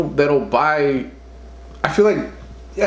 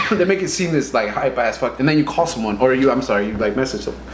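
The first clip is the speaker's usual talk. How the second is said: ordinary talk, nothing marked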